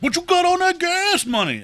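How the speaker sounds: very high, loud hustler's yell; pushy panhandler. Retold or imitated. Imitating the shirtless guy jumping up, pushy panhandler. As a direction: yell it like a brash beggar, imitating him.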